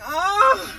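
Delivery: high, loud drawn-out "ugh"; dramatic gasped groan. Acted. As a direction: let out a hammed-up high groan. Acted reaction to love confession.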